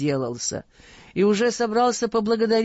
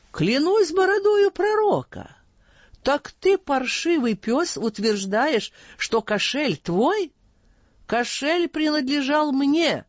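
feigned astonishment; 8 semitones higher, slower than usual. A narrator's reading. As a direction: ham up the shock, pretending to be stunned. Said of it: "с притворным изумлением воскликнул".

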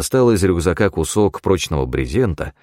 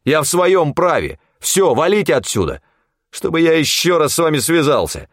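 much higher, heated scolding; angry tirade; 10 semitones higher than usual. A narrator's reading. Hunter berating cronies who left him in danger, furious. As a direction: tear into them angrily, pitched up. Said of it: "рявкнул я зло".

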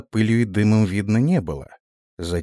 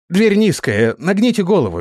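belated warning; 10 semitones higher, 4 dB louder than usual. A narrator's reading. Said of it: "запоздало продолжил офицер".

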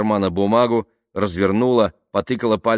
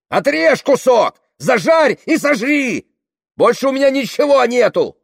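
furious scream; 8 dB louder than usual. A narrator's reading. Tamara offering the hungry woman her arm, furious scream. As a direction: scream a wild offer in rage.

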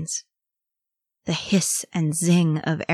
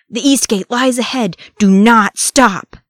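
roaring; 5 semitones higher, 9 dB louder than usual. A narrator's reading. Urging the runner on to the East Gate, roaring. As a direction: roar the command down the tunnel. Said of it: "he roared".

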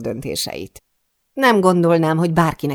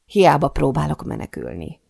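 ordinary delivery, nothing marked

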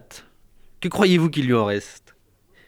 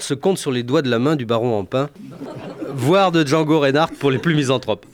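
normal talking voice

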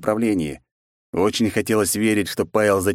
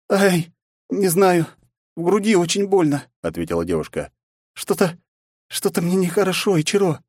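princess in pain; 11 semitones higher than usual. Acted. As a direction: speak through chest pain.

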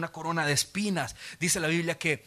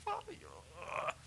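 faint whisper; 12 semitones higher, 20 dB quieter than usual. Retold or imitated. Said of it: "me decía".

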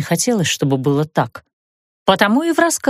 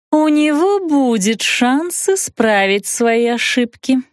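slow, menacing drawl, pitched up; sinister drawl; 6 semitones higher, slower than usual. Acted. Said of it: "зловеще протянула ведьма". (зловеще протягивая слова)

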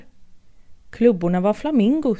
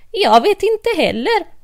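Mad Hatter; 10 semitones higher, 5 dB louder than usual. Acted. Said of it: "sa hattaren".

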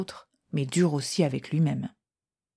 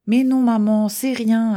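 put-on boy protesting; 5 semitones higher, 7 dB louder than usual. Boy protests to his mother.

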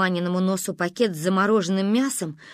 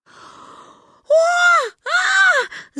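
high, loud cries of pain; pained scream; 7 dB louder than usual. A narrator's reading. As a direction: scream as if just hit. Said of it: "заорала Маня".

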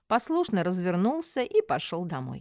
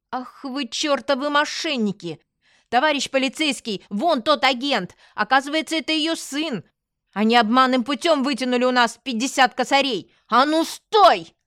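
higher, loud accusing outburst; outraged, raised-voice denunciation; indignant outcry; 5 semitones higher, 5 dB louder than usual. Acted. Heroine accuses fleeing swindlers to policeman.

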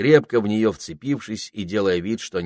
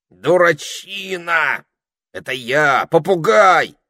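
loud, exasperated slow calling; reasoning; 10 semitones higher, 8 dB louder, slower than usual. A narrator's reading. Parrot reasoning with the panicked ermine, exasperated. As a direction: scold him back to sense. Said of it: "урезонивал его попугай".

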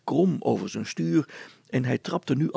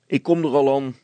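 loud, put-upon reply; grumpy; 2 semitones higher, 7 dB louder than usual. A narrator's reading. Brilstra goes to open the door, grumpily. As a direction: call back grudgingly. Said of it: "riep die knorrig".

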